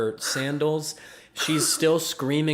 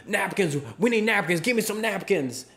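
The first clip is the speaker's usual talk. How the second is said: urgent, higher-pitched demands, lightly acted; mock yelling; 7 semitones higher than usual. Bartender imitates guy yelling for napkins, mock yelling. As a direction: imitate a frantic customer's yelled demands. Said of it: "they're yelling things".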